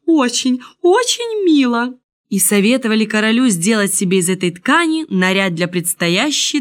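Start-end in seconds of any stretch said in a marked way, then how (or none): 0.1-1.9 high-pitched admiring gush; delighted, lifted exclamation; chorus of praise. A narrator's reading. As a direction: echo the praise like eager courtiers. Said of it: "все в один голос повторяли".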